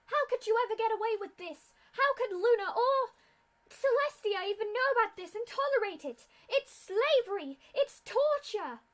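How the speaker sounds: high, very loud outraged scream; furious yell. Acted. Brave pink pony yells up at the officials in outrage, furious scream. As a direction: scream the accusation at them with everything. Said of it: "then yelled".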